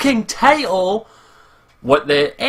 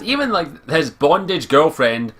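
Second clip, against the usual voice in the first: ordinary talk, nothing marked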